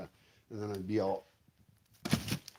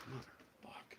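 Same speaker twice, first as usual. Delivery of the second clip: frustrated swear under the breath; hushed exasperated curse; whispered curse; 19 dB quieter, slower than usual. Real, not acted. Frustrated at uncomfortable seats.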